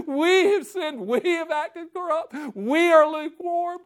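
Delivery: high, laughing-tearful confession; crying prayer. Acted. Preacher imitating a pastor crying from the pulpit. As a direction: pray it like a weeping pastor. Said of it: "From the pulpit crying for their sins".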